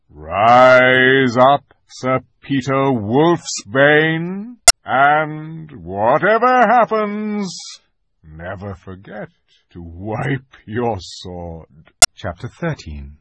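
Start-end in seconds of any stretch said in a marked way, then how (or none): 0.1-7.6 loud, slow, ceremonial proclamation rising in pitch; Aslan's knighting proclamation. A narrator's reading. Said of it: "he struck him with the flat of the blade and said". 8.2-11.9 hushed, slow, weighty counsel; Aslan's solemn admonition. A narrator's reading. Aslan ends knighting with an admonition.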